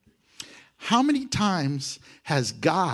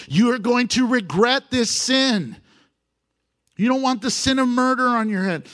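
raised, pleading warning; urgent warning; 7 semitones higher, 4 dB louder than usual. Acted. Abigail warning David, raised urgent plea. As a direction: plead with him louder to stop.

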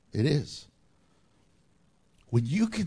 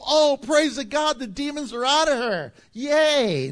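mock cheering; 5 semitones higher, 3 dB louder than usual. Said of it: "You would think everyone would go".

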